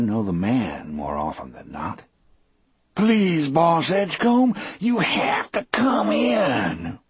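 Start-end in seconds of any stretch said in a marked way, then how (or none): 2.9-7.0 high, wailing plea to the boss; tearful high-pitched begging; desperate pleading. A narrator's reading. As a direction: beg in a high voice, dragging out the last words. Desperate prisoner begging guard.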